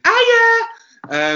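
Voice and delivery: very high, loud movie-monster screech; mock monster shriek. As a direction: burst into a parody creature scream. Mock monster shriek after suspense music.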